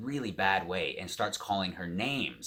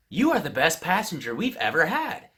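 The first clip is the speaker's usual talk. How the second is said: higher-pitched retold gushing praise; emphatic praise; 8 semitones higher, 4 dB louder than usual. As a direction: act out a crew member's delighted compliment. Retold crew member's delighted praise.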